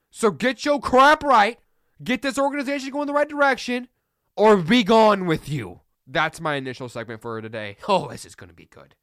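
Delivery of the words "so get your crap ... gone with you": fed-up rant. Real, not acted. Ranting at the Cardinals' coach.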